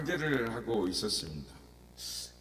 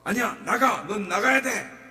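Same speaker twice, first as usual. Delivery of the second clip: loud, high insistent order; insist, no arguing; preacher insisting the spirit must go; firm shout; 7 semitones higher, 7 dB louder than usual. Retold or imitated. Preacher commanding demon.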